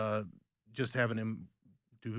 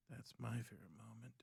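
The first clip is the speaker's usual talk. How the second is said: hushed whisper of the repeat; 23 dB quieter than usual. Shown as a demonstration. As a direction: say it again under your breath. Whispered repeat of same line.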